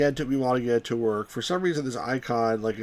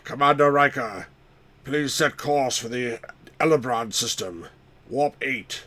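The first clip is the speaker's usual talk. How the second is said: lightly voiced starship captain; measured command with pauses between phrases; slightly put-on captain's voice; Jean-Luc Picard. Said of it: "he's like freaking Jean-Luc Picard".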